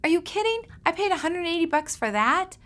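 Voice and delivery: high, loud gasp of disbelief at the price; shocked disbelief. Retold or imitated. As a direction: recall the moment you heard the bill. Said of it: "I went".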